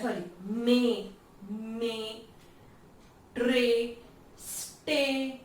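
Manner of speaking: मे, ट्रे, स्टे said slowly to show the vowel; pronunciation demo. Shown as a demonstration. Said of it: "अगर आप pronounce करोगे उसको तो देखो". (धीरे-धीरे उच्चारण दिखाना)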